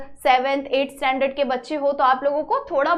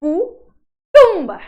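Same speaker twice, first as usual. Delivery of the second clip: कुटुंब dragged out very slowly and much louder; slow loud demo; 3 semitones higher, 10 dB louder, much slower than usual. Shown as a demonstration. Teacher sounding out a word for pupils, very slow and loud. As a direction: stretch कुटुंब out loud so every sound lands. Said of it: "कुटुंब कैसे लिखेंगे हम?".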